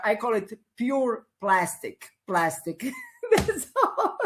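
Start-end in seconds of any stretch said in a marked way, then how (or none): 3.1-4.2 laughing. Real, not acted. Laughing end of rant.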